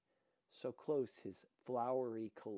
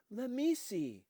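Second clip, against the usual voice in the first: eager; 10 semitones higher, 8 dB louder than usual. A narrator's reading. Boy curious, coming closer.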